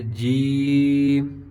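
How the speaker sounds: جیم with a long held ee; slow, sustained جیم; dropped-vowel جیم. Shown as a demonstration. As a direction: drop the sound into a stretched ee in جیم. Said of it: "آواز کو گرا کر".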